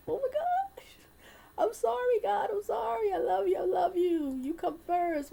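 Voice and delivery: high, loud, near-crying plea; tearful apology. Real, not acted. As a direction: cry out your sorry to God. Tearful apology after forgetting prayer.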